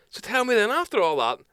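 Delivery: put-on high, loud drunk question, slowing at the end; drunk questioner. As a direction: draw the last question out high like a swaying regular. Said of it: "the man turns around and goes".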